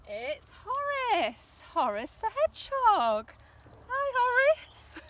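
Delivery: loud, high, stretched-out announcement; big excited children's-show welcome; delighted greeting. Pretend. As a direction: cheer the arrival of an old friend. Finds and greets hidden hedgehog toy.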